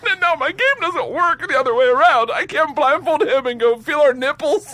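squealing high through laughter; laughing. Real, not acted. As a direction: laugh out every word, voice cracking high. Laughing while telling story.

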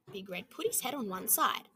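plain reading voice

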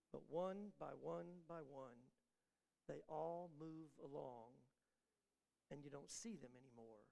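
soft, low, confiding words; hushed aside. Real, not acted. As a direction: lean in and bring it down to a hush. Sermon drops to a hush.

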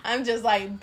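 ordinary delivery

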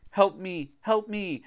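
high, thin cry for help; faint cry. A narrator's reading. Trapped Greg calls for rescue, faint cry. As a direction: cry out as if from far away. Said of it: "I heard a faint cry".

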